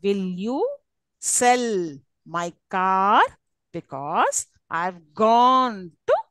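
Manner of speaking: very slow, "sell", "car", "gone" and "France" held long; exaggerated content-word stress. Shown as a demonstration. Teacher demonstrates for noticing, heavy stress on "sell", "car", "gone", "France". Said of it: "content words are stressed".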